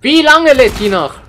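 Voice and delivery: genervter lauter Ruf; ungeduldig laut. Real, not acted. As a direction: ruf genervt über das Laden. (ungeduldiger Gamer)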